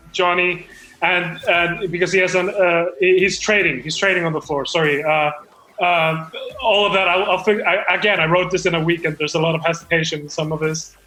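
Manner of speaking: usual speaking voice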